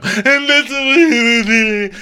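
mock-sage hostess swelling to a shriek; hostess impression. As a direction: start measured, end in a loud high squeal. Acting out the hostess, building big.

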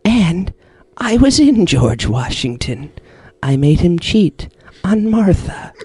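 slow, portentous possessed voice; hammy villain boast with long pauses; the Creator, Kalo impression. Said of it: "with the Kalo impression".

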